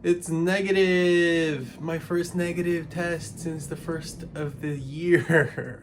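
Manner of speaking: loud, slow joyful shout; thrilled announcement; excited. Real, not acted. Celebrating negative test.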